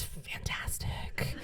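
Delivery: slow, hushed relish; confiding whisper. Acted. Mother admitting she enjoys being away from kids, slow whisper. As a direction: whisper it slowly like a guilty secret.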